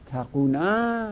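تقونا drawn out syllable by syllable; تقونا said at half speed, each sound clear; slow demo. Shown as a demonstration. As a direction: spell out تقونا slowly for the class.

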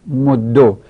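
مدو with a rounded "o/u" ending, "moddo"; damma ending. Shown as a demonstration. Teacher showing students the damma form, مدو as "moddo".

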